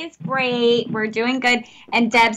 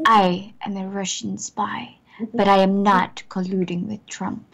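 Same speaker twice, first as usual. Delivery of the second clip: Russian spy accent; 3 semitones lower than usual. Shown as a demonstration. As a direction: read the digits as a seductive Russian spy. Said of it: "I want to sound like a sexy Russian spy".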